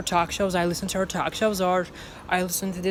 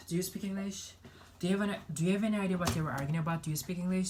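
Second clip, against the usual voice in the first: quiet mumbled practice; 2 semitones higher, 7 dB quieter, faster than usual. Shown as a demonstration. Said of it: "quiet repeating of clip lines".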